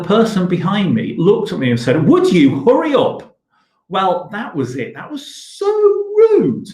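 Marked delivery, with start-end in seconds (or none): 2.1-3.4 snapped, impatient demand, higher and louder; mock impatient shopper snapping; rude demand. Rude shopper snaps at queue. 4.9-6.7 outraged, rising high to a loud rude; a teacher acting out shocked indignation; indignant. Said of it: "I was furious at their rudeness".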